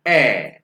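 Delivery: ए as a long, open German "ä"; German umlaut demo. Shown as a demonstration. Teacher repeats A umlaut, loud drawn-out ä. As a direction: punch and stretch ए into "äää". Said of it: "ए को कहते हैं ए".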